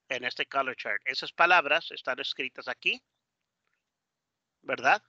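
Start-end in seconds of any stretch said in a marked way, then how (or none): none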